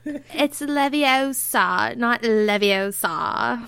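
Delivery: slow drawled Leviosa correction; valley girl. Retold or imitated. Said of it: "sound like a Valley Girl there".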